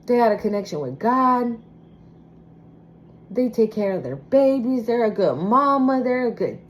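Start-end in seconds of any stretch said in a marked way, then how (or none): none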